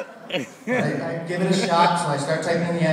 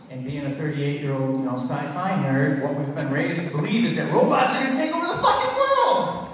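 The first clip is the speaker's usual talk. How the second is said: ordinary talk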